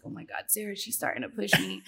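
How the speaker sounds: very soft, retold nervous aside; hushed aside. Retelling her plea to Sarah, hushed. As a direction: drop right down as if secretly appealing for help.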